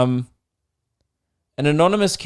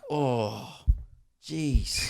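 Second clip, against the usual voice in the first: much softer, lingering "Oh, jeez."; mock impressed murmur, much softer; hushed ogling gasp; 2 semitones lower, 8 dB quieter than usual. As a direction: sigh it out like someone ogling. Imagined ogling of politicians.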